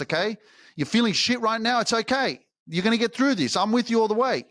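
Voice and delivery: rally the listener like a coach; fired-up coaching. Real, not acted. Intense motivational push.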